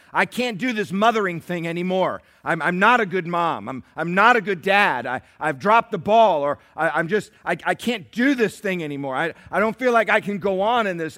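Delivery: loud stammering despair; despairing parent. Acted. A parent telling God they can't go on, loud despair. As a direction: pour out the doubt in a loud stammer.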